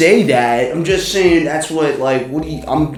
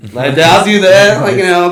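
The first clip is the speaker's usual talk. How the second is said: ordinary delivery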